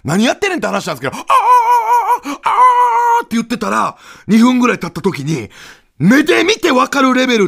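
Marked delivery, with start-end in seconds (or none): 1.2-3.2 very high, loud stretched "ah"; high rock-star wail. Retold or imitated. The rock star wailing back at the duo. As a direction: let out a piercing held cry. Trading cries.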